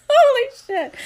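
giggly outburst; laughing. Real, not acted. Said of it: "getting all giggly".

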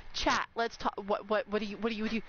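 usual speaking voice

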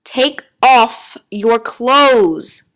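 very slow, loud, emphatic order; annoyed. A narrator's reading. Doctor repeating his order to Miranda, slow annoyed command. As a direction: repeat it with exaggerated, annoyed patience. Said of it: "the doctor's annoyed voice".

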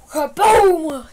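loud booming kaboom; hyped kaboom. Real, not acted. Gamer celebrating a long run, loud kaboom. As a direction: slam the word out.

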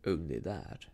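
deep, probing villain; keen suspicion. A narrator's reading. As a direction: test her, low and sharp. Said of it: "looking at me keenly".

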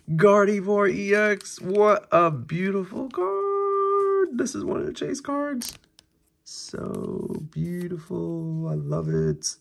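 high, slow, dreamy admiration; awed. Real, not acted. Showing viewers a beautiful card, high slow awe. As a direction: gush over the card, slowly.

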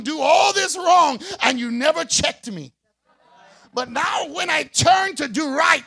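loud, high-pitched preacher's shout; preaching at full force. Acted. Preacher to congregation, roaring the point home.

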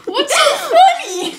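high, loud, drawn-out demand; indignant. Acted. Sal to laughing interviewer, indignant. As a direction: ask it loud and offended. Teased about the word bureau.

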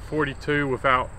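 ordinary delivery

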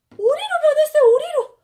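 high, loud burst of delight; excited shout. Real, not acted. Mom showing her child the duck, thrilled. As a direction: announce the duck like a big reveal.

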